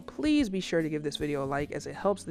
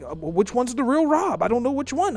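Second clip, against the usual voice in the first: confused subscriber; 5 semitones higher, 5 dB louder than usual. Pretend.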